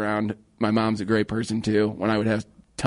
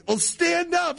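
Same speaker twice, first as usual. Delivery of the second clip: mom's voice; slower than usual. Retold or imitated. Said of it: "she's like".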